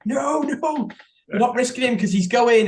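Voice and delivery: high, frantic fan protest; panicked fans. Retold or imitated. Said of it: "people were like".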